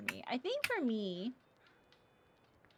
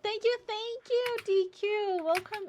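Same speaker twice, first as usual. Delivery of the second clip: excited thanks; 9 semitones higher, 6 dB louder than usual. Real, not acted. Thanking gifter on stream.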